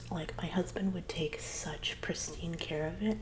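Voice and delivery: ordinary talk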